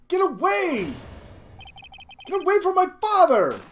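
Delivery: high, loud desperate scream; boy screaming to save his father. Acted. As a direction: scream to drive him off.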